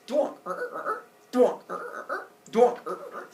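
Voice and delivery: high, punchy drilling noises; cartoon boring noises; poking sound effects. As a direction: imitate a knife twisting into shell. Imitates knife boring into shell.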